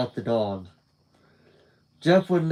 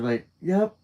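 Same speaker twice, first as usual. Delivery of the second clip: higher pitched thug with a coarse edge; 5 semitones higher than usual. Acted. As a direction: pitch it up, keep it rough. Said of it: "His voice was higher pitched than his dad's, but it had a coarse tone".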